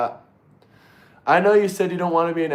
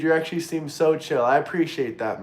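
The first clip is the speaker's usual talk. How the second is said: usual speaking voice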